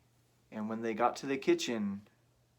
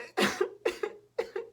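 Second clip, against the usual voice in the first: laughing. Real, not acted. Teller laughs.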